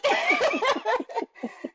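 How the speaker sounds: a high burst of real laughter. Real, not acted. Laughs after retelling the shock.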